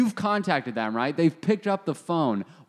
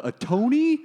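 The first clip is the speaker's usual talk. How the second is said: mock medium voice; 8 semitones higher, 3 dB louder than usual. Imitating the medium.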